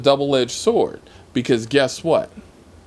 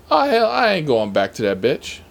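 normal talking voice

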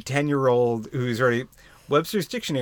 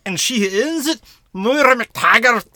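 high, loud hammy Scottish brogue; Scottish accent; 10 semitones higher, 6 dB louder than usual. Pretend. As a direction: ham up a Scottish accent, slow on the name. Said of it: "That's the best Scottish accent you've ever heard".

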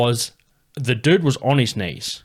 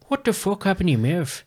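put-on Conor McGregor's Irish accent, pitched up; Irish accent impression; 9 semitones higher than usual. Host doing Conor McGregor's Irish accent. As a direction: mimic the Irish brogue, mate. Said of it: "That's not a bad accent".

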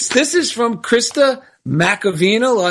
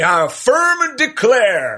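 Foghorn Leghorn; 3 semitones higher than usual. Retold or imitated. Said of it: "Foghorn Leghorn used to say".

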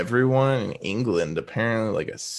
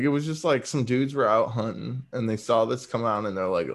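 usual speaking voice

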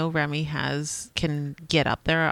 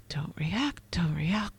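whispered self-calming; 3 semitones lower, 7 dB quieter, slower than usual. Retold or imitated. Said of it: "I'm getting really good at the clenched fist".

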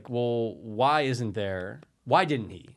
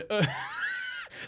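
burst of laughter; 6 dB quieter than usual. Real, not acted. Refusing to do the voice.

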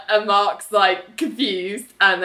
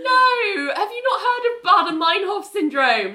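high, laughing disbelief; mock exasperated retort; 9 semitones higher than usual. Speaker retorting at Ali on screen, high laughing disbelief. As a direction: shriek it at the screen.

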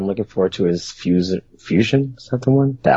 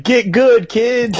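put-on high, loud taunt at kids; playful high-pitched shout; mock yell; 6 dB louder, much slower than usual. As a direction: yell it like a trash-talking gamer. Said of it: "you can yell at a bunch of kids".

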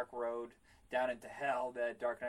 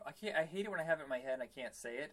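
ordinary talk, nothing marked

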